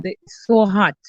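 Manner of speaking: ordinary talk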